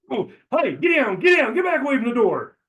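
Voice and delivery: high, loud, urgent yell at a dog through the door; panicked shout. Acted. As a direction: shout high and alarmed to hold back a dog. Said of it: "I surprised him by going".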